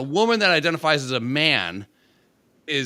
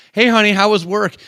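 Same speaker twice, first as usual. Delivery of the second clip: bright, louder wife greeting; cheery wife voice; 6 semitones higher, 6 dB louder than usual. Retold or imitated. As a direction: call out sweetly to husband. Voicing wife greeting husband.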